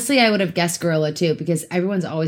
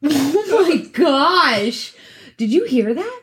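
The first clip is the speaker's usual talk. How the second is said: slow, high, hammed-up alarm; mock spooked; 7 semitones higher, slower than usual. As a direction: pretend the tree just spoke. Spooked by the murder tree.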